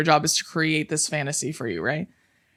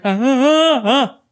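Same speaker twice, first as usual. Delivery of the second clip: higher, louder little yelps; imitated yelp; 7 semitones higher, 6 dB louder, slower than usual. Mocking men who act big, high yelps. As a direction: squeal like a scared tough guy. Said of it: "yelp when they're like".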